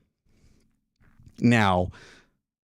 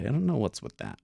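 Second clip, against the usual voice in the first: normal talking voice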